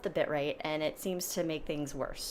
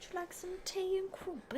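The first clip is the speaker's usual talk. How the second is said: a higher, softer mock posh English offer; posh British accent; 13 semitones higher, 9 dB quieter than usual. Shown as a demonstration. Wife mocking posh British, in light posh accent. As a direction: play a prim English host offering tea.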